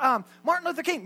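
ordinary talk, nothing marked

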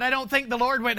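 ordinary delivery

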